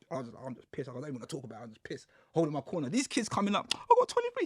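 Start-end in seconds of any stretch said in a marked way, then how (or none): 0.5-2.1 much softer, withdrawn grumble; sullen mutter. Retold or imitated. New prisoner sulking in his corner, sullen mutter. 3.7-4.5 much higher, breezy brag; like he got sweets. Retold or imitated. Young inmate bragging about his sentence, breezy brag. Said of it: "saying it like he got sweets".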